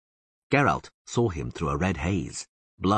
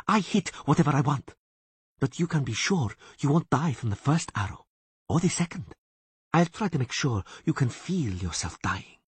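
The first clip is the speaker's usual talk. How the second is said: higher, mocking elf woman hissing; 8 semitones higher than usual. A narrator's reading. Elf woman taunting the bound Witcher, higher sneer. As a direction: taunt the bound man, pitched up. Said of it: "she hissed".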